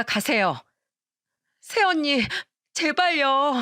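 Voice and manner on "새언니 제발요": high, urgent begging; desperate plea. A narrator's reading. Sister-in-law begs as she is sent away, desperate. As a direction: cry out please, one last time.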